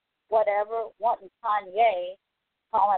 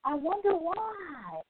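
langsam, höher, leiser, gespielt nachdenklich; mock wonder; 6 semitones higher, 6 dB quieter, much slower than usual. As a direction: fake puzzlement, drawn out and soft. Sarcastic mock wonder, then retort.

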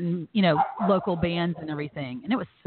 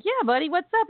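dad's cheery reply; 10 semitones higher, faster than usual. Retold or imitated. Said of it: "And he was like".

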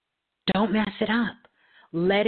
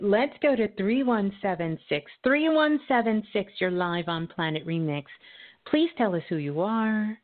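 radio host; 3 semitones higher than usual. Acted. Radio host calling up a caller.

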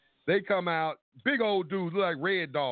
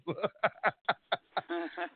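soft, high chuckle; real laugh; 4 semitones higher, 3 dB quieter than usual. Real, not acted. Laughing after introducing his homeboy to police. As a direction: laugh at your own joke.

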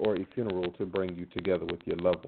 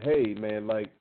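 ordinary talk

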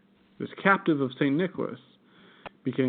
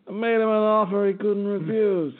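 Godfather impression; 8 semitones higher, 3 dB louder than usual. Godfather quote, mafia movie.

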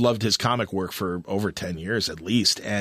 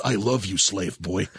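lightly voiced film-star line, dropping low at the end; a touch of old movie star, sinking in pitch; Tony Curtis impression; 5 semitones lower, slower than usual. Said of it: "it's just that Tony Curtis voice".

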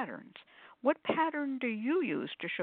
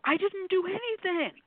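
much higher, astonished disbelief; amazed client; 12 semitones higher than usual. Retold or imitated. Pendulum students amazed it moved on its own, high astonished squeal. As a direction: squeal it like someone stunned it worked. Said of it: "They go".

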